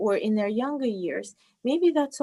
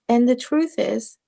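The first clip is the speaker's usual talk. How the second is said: usual speaking voice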